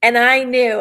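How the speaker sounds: ordinary delivery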